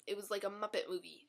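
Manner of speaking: usual speaking voice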